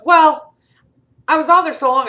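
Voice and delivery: high, loud exasperated complaint; huffing about being kept on hold; indignant dad. Retold or imitated.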